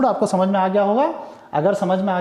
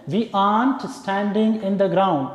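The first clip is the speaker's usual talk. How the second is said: slow dictation; much slower than usual. Shown as a demonstration. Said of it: "दिख रहा है आप लोगों को सेंटेंस".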